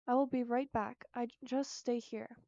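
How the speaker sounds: usual speaking voice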